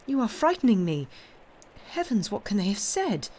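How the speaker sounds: high, agitated exclamation; alarmed granddaughter appealing to him; frightened. A narrator's reading. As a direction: let alarm lift the voice. Frightened granddaughter wonders what was said.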